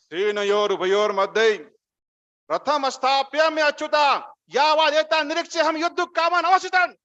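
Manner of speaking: fiery, forceful recitation rising in pitch; full of fighting josh. Shown as a demonstration. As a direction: rouse the battlefield with this verse. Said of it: "With all josh, with all fight".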